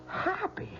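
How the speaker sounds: high incredulous "Happy?"; surprised echo. Acted. Husband startled by the question, high surprised echo. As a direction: toss the word back in disbelief. Husband astonished at pregnancy news.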